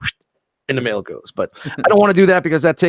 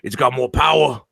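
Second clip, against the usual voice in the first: Donnie Brasco quote. Retold or imitated. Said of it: "you ever see Donnie Brasco".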